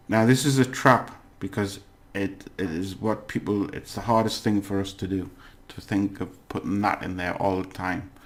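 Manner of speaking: normal talking voice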